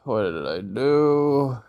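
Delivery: slow dramatic dismay; mock regret. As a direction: drag it out in mock horror. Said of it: "be like".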